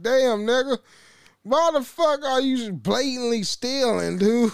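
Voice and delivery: retold exasperated "what are you doing"; incredulous. Worker retelling his reaction to a brazen thief, incredulous.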